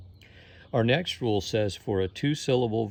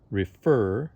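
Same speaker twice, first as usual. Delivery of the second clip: "refer" with the second syllable "fer" louder; stress on second syllable; 6 dB louder than usual. Shown as a demonstration. Teacher showing which sound is stressed, "refer" stressed on "fer". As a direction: lean hard on the "fer" of "refer". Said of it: "the second sound in the word is stressed".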